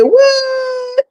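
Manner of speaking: imitating a shocked "What?", high and drawn out; mock shocked what. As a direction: squeal "What?" like the judge did. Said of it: "Judge T said".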